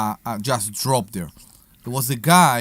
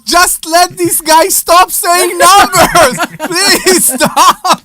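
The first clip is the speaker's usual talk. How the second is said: laughing; 14 semitones higher, 9 dB louder, slower than usual. Real, not acted. Laughing, begging him to stop.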